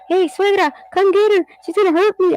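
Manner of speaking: high, whiny mock panic; mock scared plea. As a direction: squeal for help from the mother-in-law.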